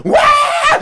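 very high, loud wail; pained yell. A narrator's reading. Anansi fleeing the whipping cord, pained yell. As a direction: shriek it out in comic agony. Anansi leaps off under beating.

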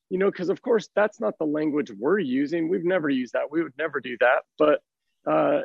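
normal talking voice

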